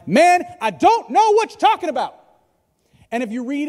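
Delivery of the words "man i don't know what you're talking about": higher, indignant outburst; angry denial. Acted. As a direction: snap back in angry, defensive denial. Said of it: "Peter replied ... now he's just angry".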